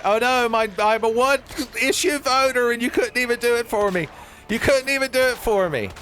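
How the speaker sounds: high, loud whining protest; whiny complaint. Acted. Player griping at the game about dogs, high whine. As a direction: whine it out as a wronged one-issue voter.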